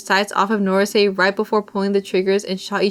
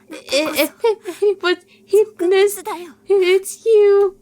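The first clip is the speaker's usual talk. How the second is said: put-on much higher, shrill-free girl voice yelling; defiant girl shout; 10 semitones higher than usual.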